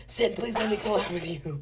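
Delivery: high, pleading fan voice; begging voice. Retold or imitated. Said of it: "PewDiePie begging Sin for a collab".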